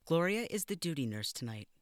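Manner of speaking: briefing the new porter; stern matron. Acted.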